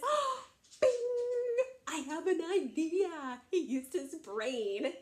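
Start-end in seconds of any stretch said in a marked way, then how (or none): 0.1-3.4 high, loud burst of inspiration; sudden bright idea. Acted. Littlest goat telling his brothers he has an idea, excited.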